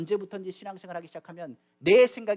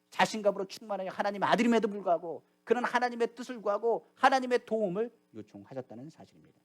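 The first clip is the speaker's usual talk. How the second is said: usual speaking voice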